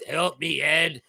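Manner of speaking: normal talking voice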